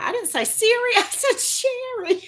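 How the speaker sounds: high-pitched, laughing correction; playful protest. Real, not acted. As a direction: squeal it in mock indignation. Mock protest at a voice assistant.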